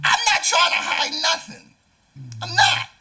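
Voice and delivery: sharp high shouted denial; heated shout. Real, not acted. Shouting back at the critic in their feud. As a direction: yell it back, refusing the accusation.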